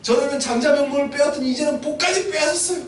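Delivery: high, sustained wailing protest; acted crying complaint. Wronged son to his father, crying complaint. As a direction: lament the theft as if crying. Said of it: "막 우는 거예요".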